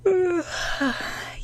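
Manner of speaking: a long drawn-out yawn sliding down; big yawn. A narrator's reading. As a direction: stretch into a sleepy yawn.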